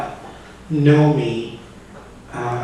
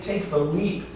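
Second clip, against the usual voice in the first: quiet, much softer poetic prompt; soft urging; 3 semitones higher, 8 dB quieter, slower than usual. Acted. Wind telling the poet to step off, soft urging. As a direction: urge it gently, lifting at the end. Wind's urging inside poem.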